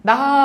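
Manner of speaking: ডাহা, the visarga turned into an added h; visarga pronunciation demo. Shown as a demonstration. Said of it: "উচ্চারণটা এরকম". (বিসর্গের হ উচ্চারণে ডাহা)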